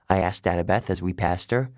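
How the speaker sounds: ordinary delivery, nothing marked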